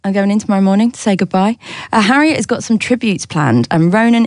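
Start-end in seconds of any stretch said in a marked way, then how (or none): none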